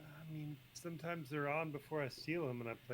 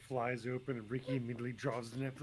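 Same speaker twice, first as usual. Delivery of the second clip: ordinary delivery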